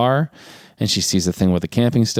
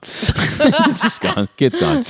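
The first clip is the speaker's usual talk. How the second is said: real laughter through the words; high, helpless giggling delivery; amused laughing; much slower than usual. Real, not acted. Host laughing at the movie's forgotten kid, amused and high-pitched.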